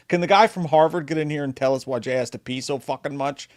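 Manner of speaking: ordinary delivery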